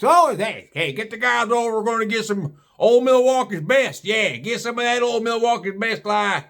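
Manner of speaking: lightly voiced beer-buddy biker; mocking the Harley pack.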